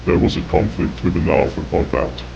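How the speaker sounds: ordinary delivery, nothing marked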